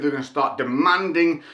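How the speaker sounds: ordinary delivery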